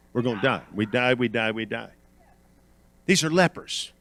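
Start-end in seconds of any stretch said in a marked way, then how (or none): 0.1-1.9 sudden drop to low, soft voice; low, understated repetition; hushed refrain. Real, not acted. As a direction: let it fall away quietly. Drops after shouted refrain.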